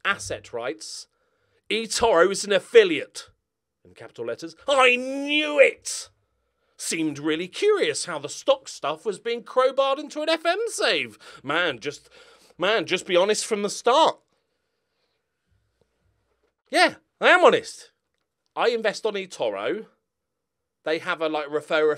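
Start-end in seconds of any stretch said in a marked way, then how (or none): 1.7-14.2 higher and louder, gloating at the start; mock accusing commenter. Shown as a demonstration. YouTuber reading a commenter accusing him of hidden sponsorship. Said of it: "a small dramatic reading". 16.7-17.9 much higher, louder protest; indignant retort. Real, not acted. YouTuber defending himself against an accusing commenter, indignant.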